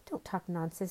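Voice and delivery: ordinary delivery, nothing marked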